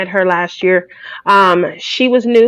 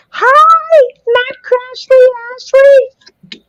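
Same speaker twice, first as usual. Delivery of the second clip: playful put-on voice; 14 semitones higher, slower than usual.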